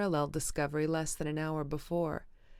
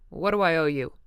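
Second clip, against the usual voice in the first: louder, curt harsh question; harsh demand; 8 dB louder than usual. A narrator's reading. Tom to Wilson, cutting him off harshly. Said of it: "demanded Tom harshly".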